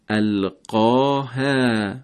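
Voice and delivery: القاها with a thin, light ha, not full and heavy; Arabic ha kept light. Shown as a demonstration.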